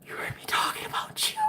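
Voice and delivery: hushed, unvoiced disbelief; stunned whisper. Whispering shock to the man who heard her thoughts. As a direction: whisper it like you're spooked.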